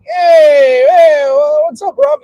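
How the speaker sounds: high, loud, drawn-out hail; excited greeting. Acted. Founding fathers greeting him in heaven, acted excited shout. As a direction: cheer an arriving friend.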